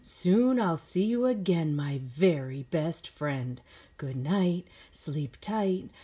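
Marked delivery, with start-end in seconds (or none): none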